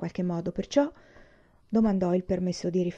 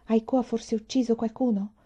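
usual speaking voice

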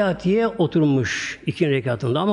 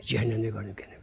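hushed whisper; 7 semitones lower, 10 dB quieter, faster than usual. Retold or imitated. Wife recounts hellfire dream.

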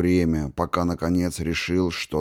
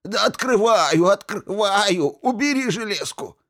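gurgling alien voice; 13 semitones higher than usual. A narrator's reading. Archmage begs under sword.

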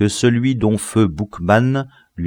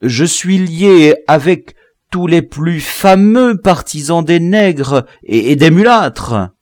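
high, slow, loud protest; strained, emphatic self-defence; anxious pleading; 10 semitones higher, 7 dB louder, slower than usual. A narrator's reading. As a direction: stumble eagerly through the boast. Prisoner pleads connections to save himself.

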